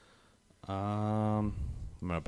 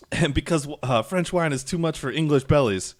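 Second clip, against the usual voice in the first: raised, loud, taunting quote; trash-talking Frenchman; 6 semitones higher, 5 dB louder than usual. Retold or imitated. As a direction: imitate a boastful trash-talker, pitched up and louder. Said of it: "all the French guys talking, uh, talking trash".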